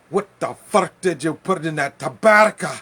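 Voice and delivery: loud, high furious yell; angry yell. Retold or imitated. Customer kicking the door open, yelling furiously. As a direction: storm in and yell at the seller.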